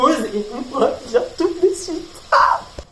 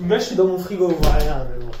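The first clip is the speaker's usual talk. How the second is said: ordinary delivery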